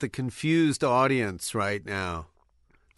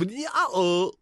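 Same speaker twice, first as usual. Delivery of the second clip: reversed gibberish speech; played backwards; 5 semitones higher than usual. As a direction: sound like a tape in reverse. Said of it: "We'll do that backwards".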